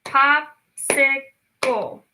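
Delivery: Pop-si-cle slow, one clap per beat; clapped syllables. Shown as a demonstration. Teacher clapping out syllables. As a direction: clap each beat of Popsicle. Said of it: "Let's try to clap it out".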